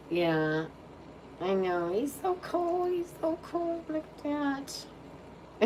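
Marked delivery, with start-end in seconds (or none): 1.9-2.9 much higher squeaky pet voice. Real, not acted. Cooing about the dog. 2.9-5.6 much higher squeaky pet voice. Real, not acted. Cooing about the dog.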